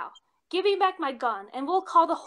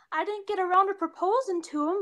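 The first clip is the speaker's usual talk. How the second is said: normal talking voice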